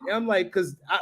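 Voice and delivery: ordinary delivery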